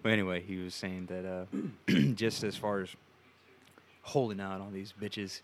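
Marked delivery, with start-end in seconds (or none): none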